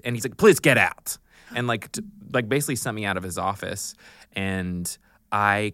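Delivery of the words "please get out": put-on loud, higher accented dismissal; dismissive director. Director sending actress out of office, loud and accented. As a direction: imitate a director throwing someone out.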